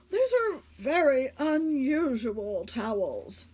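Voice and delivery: lightly voiced puzzled husband; Mister Rogers. Husband puzzled by bulbs outside, mildly voiced. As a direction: ask it slow and baffled.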